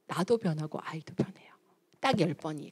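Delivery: soft unvoiced whisper. Real, not acted. Coach whispering to mothers that ten times changes them, whispered. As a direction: let it fall to a secret whisper. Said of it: "whispered promise".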